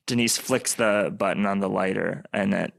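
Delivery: normal talking voice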